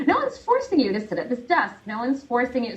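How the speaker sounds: high, incredulous protest after a laugh; exasperated retort. Acted. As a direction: throw it at the complainers, pitched well up. Mock exasperation at job complainers.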